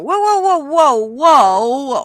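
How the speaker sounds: high, loud whoa-whoa to halt him; mock stop-right-there call; playful imitation of a president calling whoa; imitating a president. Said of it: "we actually had a president that went".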